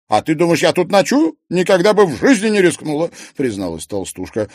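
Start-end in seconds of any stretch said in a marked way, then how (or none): 0.1-3.1 high, loud, animated retort; emphatic plump colleague. Acted. Maryana would never risk a night here, emphatic. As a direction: retort emphatically, pitched well up.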